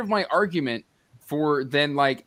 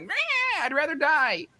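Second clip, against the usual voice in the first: high, bratty mock whine; petulant child. Mimicking the elf refusing rescue, petulant child. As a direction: act out a childish, sulky refusal. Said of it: "like a petulant child".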